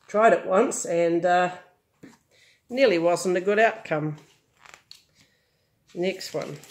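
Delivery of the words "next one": ordinary delivery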